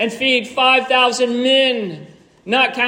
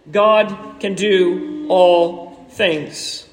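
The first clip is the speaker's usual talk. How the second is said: slow, deliberate, word by word; emphatic declaration; 3 semitones lower, much slower than usual. Real, not acted. Preacher sums up God's power to the congregation, slow and emphatic. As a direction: space out every word for weight. Preacher drives home his point.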